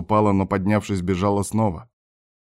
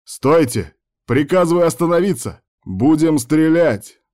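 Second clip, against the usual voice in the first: loud, high-pitched warning shout; shouted military order; 8 semitones higher, 6 dB louder than usual. A narrator's reading. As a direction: order a fugitive to halt, drawing out the threat. Soldiers threaten to shoot at fugitives.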